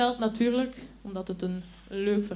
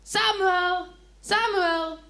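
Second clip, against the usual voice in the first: high ringing call from afar; 9 semitones higher, 4 dB louder, much slower than usual. A narrator's reading. Unseen voice calling the boy from afar. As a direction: call his name across the dark. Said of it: "Iemand roept hem".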